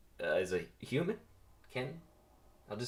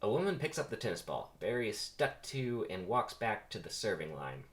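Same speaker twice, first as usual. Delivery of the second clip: usual speaking voice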